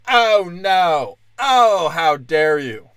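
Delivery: mock outrage. Comic mocks a heckler outraged at jokes, loud. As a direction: huff like a scandalised prude. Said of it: "Anytime a guy does a joke".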